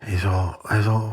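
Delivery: usual speaking voice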